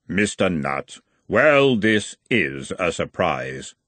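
slow, unearthly Death; grim reaper voice. Acted. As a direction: speak as Death, unhurried. Death speaks to dying man.

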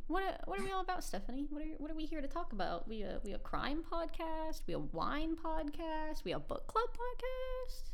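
high, slow mock-wailing questions; high mock whine. Mock questioning Stephanie, high pitched.

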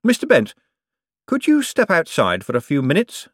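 high breezy politeness; bright. A narrator's reading. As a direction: briskly shoo him out with a smile. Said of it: "said Moist brightly".

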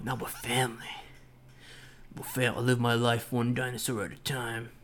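hammy, put-on movie tough-guy voice; badly faked film-hero voice; Vin Diesel. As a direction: do a terrible impression of an action star. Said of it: "terrible Vin Diesel impression".

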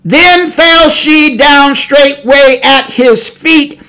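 slow weighty preaching cadence; solemn scripture reading. Acted. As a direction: read it like the final blow. Preacher reads scripture climax slowly.

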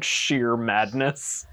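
usual speaking voice

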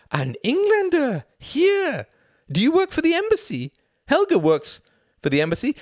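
very high, loud, eager prying; nosy Greta. Acted. Greta quizzes Tom about the embassy in a very high voice. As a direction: pounce on the newcomer.

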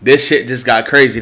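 ordinary talk

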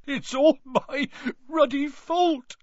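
high, drawn-out acted wail; sobbing. A narrator's reading. Hagrid blaming himself to Harry, sobbing. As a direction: blame yourself through heavy sobs. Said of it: "he sobbed".

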